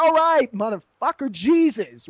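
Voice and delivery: loud, high burst, then easing off; exasperated retort. Retold or imitated. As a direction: exclaim it in retold exasperation.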